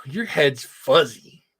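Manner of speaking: lightly voiced friend, drawn out slowly; a hint of a buddy teasing, slow; slow teasing imitation. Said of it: "he scruffs my hair and he goes".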